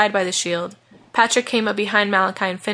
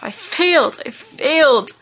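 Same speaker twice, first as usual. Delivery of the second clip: high, despairing repeated wail; loud, grief-stricken self-reproach; anguished cry; 7 semitones higher, 4 dB louder, slower than usual. A narrator's reading. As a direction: blame yourself, crying out each time. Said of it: "he cried over and over to himself".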